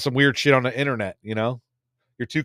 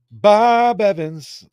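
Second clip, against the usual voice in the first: mock announcer; 8 semitones higher, 8 dB louder, much slower than usual. Drawn-out name, mock announcer.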